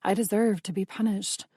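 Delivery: usual speaking voice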